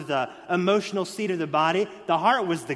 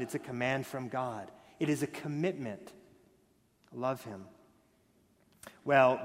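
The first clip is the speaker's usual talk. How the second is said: softer, lower pastoral voice; hushed earnest preaching; 6 semitones lower, 5 dB quieter than usual. Real, not acted. Pastor urging husbands and wives, soft and low. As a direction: lean in and press it home gently. Pulls down into an intimate hush.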